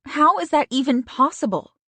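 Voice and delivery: a friend outraged on her behalf; shocked friend. Acted.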